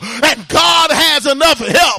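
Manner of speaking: loud, rhythmic sermon delivery with uh breaths; fiery preaching. Acted. Preacher urging the church to call on God, fiery preaching. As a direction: preach it hard to the congregation.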